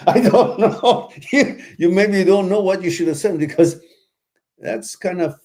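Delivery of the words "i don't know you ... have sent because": laughing. Real, not acted. Laughing at caller's flower story.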